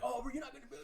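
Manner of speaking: high, laughing mock dismay; laughing fan voice. Fans on Instagram asking, high laughing. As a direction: fans disappointed, said through laughter.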